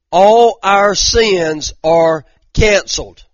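slow, loud, emphatic scripture reading; preaching scripture. Acted. Preacher reading the scripture to the congregation, slow and loud. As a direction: preach each phrase out slow and heavy.

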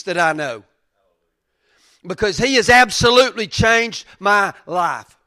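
loud, high fervent preaching; preaching at full force. Real, not acted. Pastor pleading with the congregation, loud and fervent. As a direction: cry it out with conviction.

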